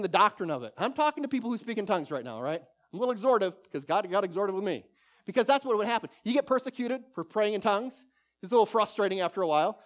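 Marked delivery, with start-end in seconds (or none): none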